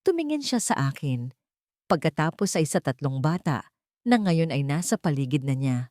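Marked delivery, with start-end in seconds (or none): none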